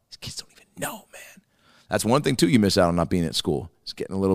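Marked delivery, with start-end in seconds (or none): 0.1-1.4 hushed, voiceless whisper; whispered aside. Real, not acted. Comedian musing to himself about the kids, whispered aside. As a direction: drop right down to a whisper.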